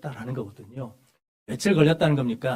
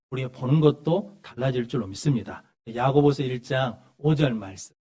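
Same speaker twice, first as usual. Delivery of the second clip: usual speaking voice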